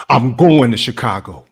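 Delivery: loud, defiant outburst; Floyd Barton. Acted. Auditioning actor vows as the character, defiant declaration.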